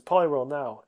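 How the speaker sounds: loud, sudden trainer's order; retold battle command. Red gives Poliwhirl the signal to attack, commanding. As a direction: call out the attack cue.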